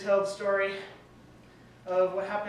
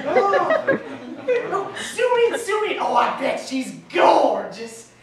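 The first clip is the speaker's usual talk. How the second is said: squealing dad, much higher and louder, laughing; high-pitched excited dad; 9 semitones higher, 9 dB louder, much slower than usual. Retold or imitated. Dad gushing over son's phone call, shrill mock squeal. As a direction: gush like an overjoyed father. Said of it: "speaking high-pitched and everything".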